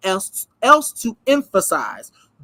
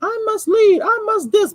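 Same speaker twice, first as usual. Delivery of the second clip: much higher, pompous mimicry; high mock pompous leader voice; mocking self-important man; 12 semitones higher than usual. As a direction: send up the man's grand claims. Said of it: "it's this idea of".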